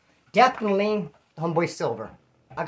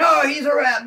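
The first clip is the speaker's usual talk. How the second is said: mock accusing shout; 8 semitones higher, 5 dB louder than usual. Retold accusation in prison fight.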